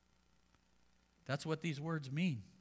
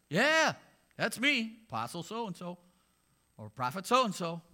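much higher, louder smug self-praise; mock proud apostle; 6 semitones higher, 10 dB louder than usual. Preacher imitating a vain apostle basking in praise, high and loud.

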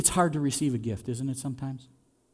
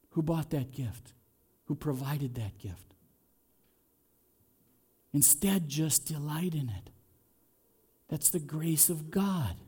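quiet, tender preaching; 9 dB quieter than usual. Real, not acted. Preacher softly urging the flock to delight, hushed. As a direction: drop to a hushed soft voice. Soft close after climax.

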